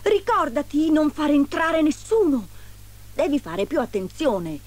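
high, firm warning easing down; admonishing. A narrator's reading. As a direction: warn firmly, starting high. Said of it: "la ammonì il maggiore dei nani".

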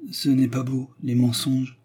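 plain reading voice

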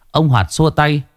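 usual speaking voice

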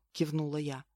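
ordinary delivery, nothing marked

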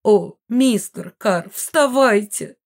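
high, slow, drawn-out urging; effusive mother. A narrator's reading. Mother urges the fallen мистер Карр up, high effusive plea. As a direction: coax him grandly, stretching each word.

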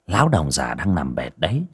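usual speaking voice

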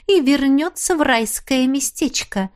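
ordinary delivery

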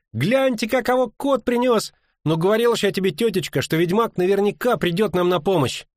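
high-pitched, brash delivery; cheeky crowing. A narrator's reading. Brash friend greeting the witcher, cheeky crowing. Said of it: "знакомый нахальный голос".

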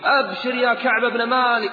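high, loud proclamation; shouting good news. Acted. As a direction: cry it out as if from a height. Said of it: "يصرخ بأعلى صوته".

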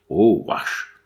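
loud spelt-out retching noise; disgusted retch. A narrator's reading. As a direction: heave it out in revulsion. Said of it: "se débarrassant l'estomac".

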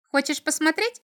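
high, piping imp question; curious little devil. A narrator's reading. Little devil offers to show the castle, curious. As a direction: ask temptingly in a small high voice. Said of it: "поинтересовался чертёнок".

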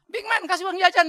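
high loud fond call, first time; Mami calling. Retold or imitated. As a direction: bellow it out like an auntie. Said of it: "panggil saya". (panggilan Mami yang keras dan tinggi)

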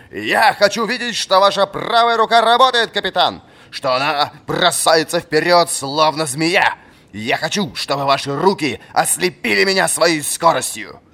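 put-on high, loud demanding old soldier; stern old fencing master. A narrator's reading. Old fencing master demanding speed from his pupil. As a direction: drive the pupil hard with every word.

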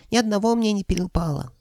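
very high, piping creature voice; sighing dragon head. A narrator's reading. Middle head lamenting its missed share, high sighing voice. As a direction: sigh it in a falsetto. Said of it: "вздохнула средняя".

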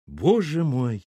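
slow, overcome groan; moaning. A narrator's reading. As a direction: moan it, tearfully moved. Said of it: "простонала Валентина, утираясь". (протяжно, со стоном)

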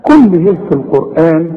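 ordinary delivery

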